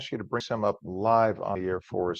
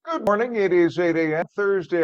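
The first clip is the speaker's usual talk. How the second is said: bright greeting; 7 semitones higher, 8 dB louder than usual. Acted. TV host opening the hour.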